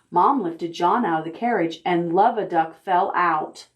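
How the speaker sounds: usual speaking voice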